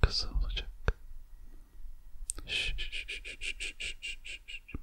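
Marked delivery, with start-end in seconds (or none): 2.5-4.7 fading soothing shushing. Acted. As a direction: shush gently, slower and fading. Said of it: "shushing to soothe listener".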